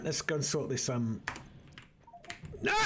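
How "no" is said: high, pained groaning sigh at a sudden death; real dismay at dying; exasperated wail. Real, not acted. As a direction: let out a long anguished moan. Game character dies suddenly.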